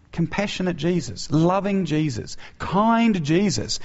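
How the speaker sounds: high, slow mock-sweet listing; pointed sarcasm before the sting; sarcastic. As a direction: drip irony on each name, slowly. Ironic contrast with harsh reply.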